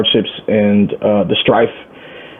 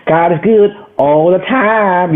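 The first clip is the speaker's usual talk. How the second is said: church call; 8 semitones higher, slower than usual. Retold or imitated. Quoting Baptist church call.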